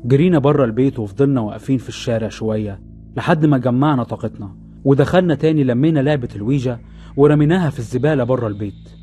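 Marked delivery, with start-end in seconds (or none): none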